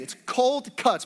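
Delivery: COLD CUTS drawn out slowly, word by word; slow, separate words. Shown as a demonstration. Explaining a food to puzzled people, slow and distinct. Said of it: "but you say it fast".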